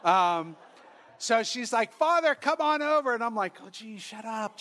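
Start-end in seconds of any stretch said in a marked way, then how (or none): 1.9-3.1 calling over. Retold or imitated. Salon owner hailing the priest, calling over. 3.6-4.6 softer, muttered retold aside; embarrassed hushing. Priest to the woman hailing him, embarrassed hushing.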